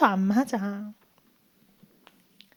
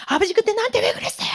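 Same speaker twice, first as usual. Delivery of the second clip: acted-out sobbing accusation, high and fast; tearful reproach; 8 semitones higher, faster than usual. Crying son confronts his professor father, tearful reproach. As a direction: blurt it out like a crying grown son. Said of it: "그 아들이 펑펑 울어요".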